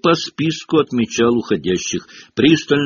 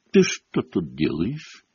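slow, low, suspicious questioning; menacingly slow caretaker; 4 semitones lower, 4 dB quieter, slower than usual. A narrator's reading. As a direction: draw the question out distrustfully. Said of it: "подозрительно сощурился Филч".

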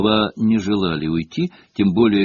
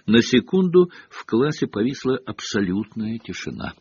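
plain reading voice

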